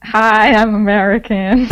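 loud, hammed-up American accent. Shown as a demonstration. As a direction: put on a big American greeting. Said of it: "an American accent?".

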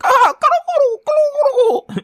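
shrieking high laughter; wild boisterous cackle. Acted. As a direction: cackle like a mad jolly king.

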